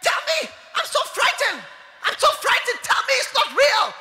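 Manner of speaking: very high, loud shouting plea; terrified plea. Retold or imitated. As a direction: beg in terror at death's door. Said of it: "She immediately held him and said".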